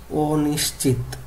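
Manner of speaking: অনিশ্চিত drawn out slowly, syllable by syllable; careful pronunciation. Shown as a demonstration. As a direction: model the word অনিশ্চিত slowly for students. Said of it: "তখন কিভাবে এটা হবে?".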